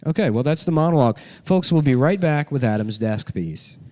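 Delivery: TV host sign-off. Pretend. Signing off like a host.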